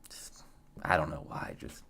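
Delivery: voiceless, hushed aside; whisper. Real, not acted. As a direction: whisper it to yourself, puzzled. Muttering to himself on stream.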